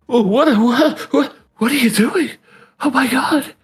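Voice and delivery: high, panicky embarrassed voice; flustered girl. Retold or imitated. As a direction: squeak it out in embarrassment. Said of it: "Subaru was like".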